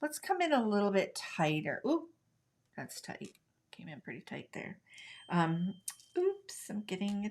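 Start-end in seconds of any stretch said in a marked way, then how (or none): 2.8-4.8 whispered aside to herself; a soft, breathy under-the-breath remark; hushed aside. Real, not acted. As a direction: drop to a near whisper as the camera zooms in. Camera zooms in close.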